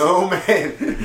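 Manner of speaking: laughing in disbelief; a real laugh through the words; oh man, laughed out. Real, not acted. Reacting to topic with laugh.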